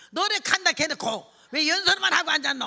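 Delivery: loud, high dialect scolding; complaining old man. Retold or imitated. Old man scolding preacher who promised songs, loud indignant complaint. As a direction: heckle indignantly in Gyeongsang dialect. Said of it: "이 할아버지 하나가 이래요".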